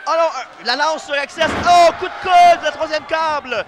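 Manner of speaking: high, loud, slow final count; excited climax call. Acted. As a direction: draw out the winning count. Final pin called.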